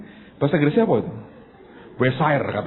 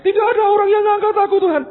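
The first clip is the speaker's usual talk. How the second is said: loud, high-pitched retold grumble; acted-out griping at the top of the voice; grumbling; 7 dB louder than usual. Sick man by Bethesda pool complaining to the Lord, grumbling. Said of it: "Malah ngomel".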